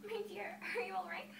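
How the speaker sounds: hint of a solicitous fairy; concerned fairy. Fairy speaks to Cinderella.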